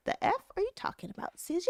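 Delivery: high, hushed incredulity; quiet high-pitched retold outrage; incredulous mother. Mother's disbelief in retold call.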